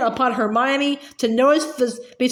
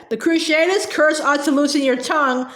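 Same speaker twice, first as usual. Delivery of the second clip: ordinary delivery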